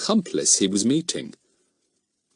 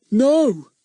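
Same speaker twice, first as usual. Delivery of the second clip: very high, loud stubborn refusal; cling to it like a frightened old tramp; old man refusing; 5 dB louder than usual. Acted. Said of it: "clutching the sack".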